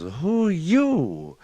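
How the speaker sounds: high, loud, drawn-out rabbit voice; startled question. Acted. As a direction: stretch the question out high in storyteller's astonishment. Rabbit meets giant cat head.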